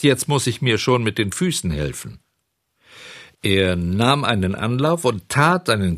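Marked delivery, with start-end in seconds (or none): none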